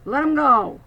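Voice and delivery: put-on stern old pirate command, loud; commanding old man. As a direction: order him off. Old pirate orders bully.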